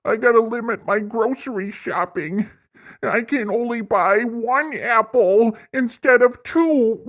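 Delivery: high, whining mock voice with laughs; high, whiny parody of a complaining liberal; mocking whiny complainer. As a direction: whine like a broke crybaby over gas prices. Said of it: "go like".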